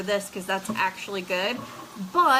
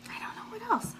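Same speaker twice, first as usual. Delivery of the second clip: whisper; 13 semitones higher, 9 dB quieter than usual. Real, not acted. Whispered aside while tasting.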